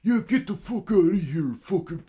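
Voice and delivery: very high, loud mocking jeer; jeering heckler. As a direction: mimic him taunting behind your back.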